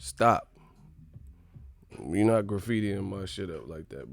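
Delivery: ordinary talk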